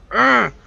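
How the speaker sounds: a loud, high groan of real frustration; frustrated groan. Real, not acted. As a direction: let the indecision burst out as a groan. Torn over the game choice.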